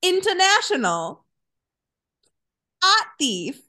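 high, punchy mock Boston accent. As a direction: lean hard into a broad Boston accent. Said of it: "a wealth of Boston accents".

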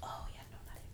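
whispering. Real, not acted. Whispered refusal.

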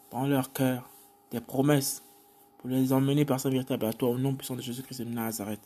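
soft, low intercession; hushed petition in low murmured phrases; spoken prayer. Real, not acted. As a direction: pray quietly to the Father. Prays aloud to God.

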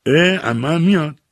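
high, slow groan of surprise; dismayed surprise. Acted. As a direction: let the dismay rise high and drag. Dismayed that aunt comes too.

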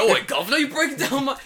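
loud, high hammed-up British accent. Homeowner confronting police at his door, loud hammed-up British accent. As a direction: overdo a Cockney homeowner. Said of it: "make him really British".

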